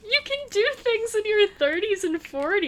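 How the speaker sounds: high, loud indignant objection; worked-up, emphatic rebuttal; loud protest. Real, not acted. Keeps protesting friend's claim.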